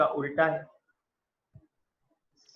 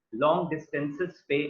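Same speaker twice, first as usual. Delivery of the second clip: ordinary talk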